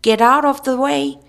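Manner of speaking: high, loud warning yell; alarmed shout. A narrator's reading. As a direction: yell it to clear the path.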